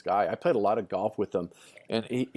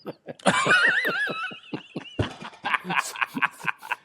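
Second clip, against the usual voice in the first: high, wheezing laugh; real laughter. Real, not acted. Storyteller cracking up at his own punchline, high laughter. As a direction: crack up helplessly.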